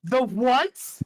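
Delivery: high, loud, incredulous repeat; shocked disbelief. Real, not acted. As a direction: shout it in disbelief. Repeats louder, higher.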